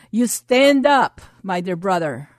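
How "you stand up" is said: loud slow command to rise; commanding shout. Acted. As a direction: call them up with full authority.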